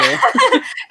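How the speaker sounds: bright burst of laughter. Real, not acted. Laughing at herself while recalling her readathon vlog. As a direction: laugh at her own binge-reading.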